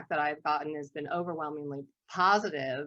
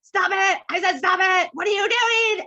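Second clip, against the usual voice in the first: high, loud shrieking squabble between siblings; shrill sibling fight, shouted high; kids bickering shout; 14 semitones higher, 10 dB louder than usual. Retold or imitated. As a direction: yell it like two kids fighting over a toy. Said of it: "You know what that sounds like".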